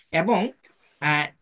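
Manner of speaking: ordinary talk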